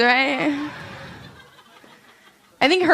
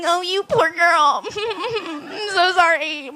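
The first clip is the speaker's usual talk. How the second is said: slow, higher, hammed-up pity; mock sympathy; 6 semitones higher, slower than usual. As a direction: coo fake condolences. Mock pity to crying girl.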